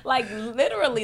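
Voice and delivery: laughing as she says it; laughing about how well the clinic treats her. Real, not acted.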